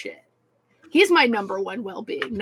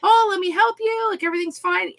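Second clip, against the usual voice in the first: much higher, chirpy store manager reassurance; sugary fake calm for angry customers; fake cheerful customer service; 9 semitones higher, 4 dB louder than usual. Store manager soothing pissed customers, fake cheerful. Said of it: "I'd have to go up and be like".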